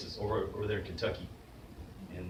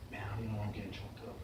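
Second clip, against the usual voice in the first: quiet choked-up mumble; 10 dB quieter, much faster than usual. Retold or imitated. Retelling a tough kid choking up.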